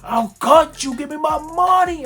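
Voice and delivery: furious shouted threat. Retold or imitated. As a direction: roar it like you'll really grab a knife.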